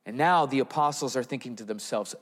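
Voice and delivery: ordinary talk, nothing marked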